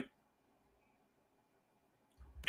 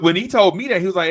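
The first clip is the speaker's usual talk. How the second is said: ordinary talk, nothing marked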